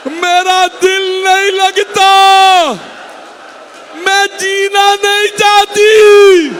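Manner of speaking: high, very slow wailing cry; weeping lament. Acted. Grieving mother crying out to her dead son, wailing high and slow. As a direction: wail each phrase, drawn out and loud. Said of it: "रो रो के कहने लगी".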